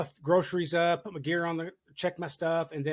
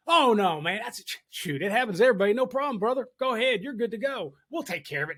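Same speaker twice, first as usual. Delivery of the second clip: reassuring; 6 semitones higher, 4 dB louder than usual. Retold or imitated. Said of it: "I was like".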